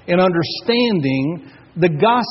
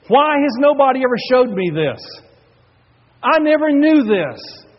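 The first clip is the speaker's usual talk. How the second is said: higher and louder, voicing her tearful dismay; tearful outburst; 9 semitones higher, 6 dB louder than usual. Pastor voices the weeping woman's outburst, tearful. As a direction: cry out the question through retold tears. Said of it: "she burst into tears".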